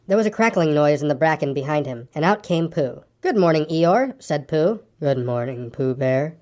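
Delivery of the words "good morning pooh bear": low, dejected donkey voice; gloomy. A narrator's reading. Eeyore answers Pooh's greeting, low and gloomy. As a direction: return the greeting heavily and low. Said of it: "said Eeyore gloomily".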